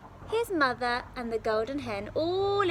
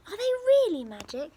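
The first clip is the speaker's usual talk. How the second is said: high, quick and excited; bright disbelieving question; eager boy voice; 8 semitones higher, 6 dB louder, faster than usual. Acted. Jack asks excitedly.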